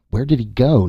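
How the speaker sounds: peeved, pitch lifted; irritable. A narrator's reading. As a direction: ask it with audible annoyance. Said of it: "Nasha said irritably".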